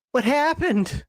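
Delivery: acted-out puzzled cry; mock bafflement. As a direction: ask it high, as if baffled. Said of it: "twenty-two years later going".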